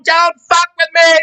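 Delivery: high, loud, slow diva shout; hammed-up Faye Dunaway as Crawford; Joan Crawford boardroom. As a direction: bark it like a screen queen. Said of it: "a la Faye Dunaway as Joan Crawford in the boardroom".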